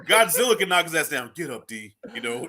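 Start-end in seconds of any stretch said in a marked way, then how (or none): 1.3-1.9 low, very soft quoted line; soft urging. Retold or imitated. Her words beat even Godzilla's knockout, softly urging. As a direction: say it hushed, well under the chatter. Same quote, Bianca reviving him.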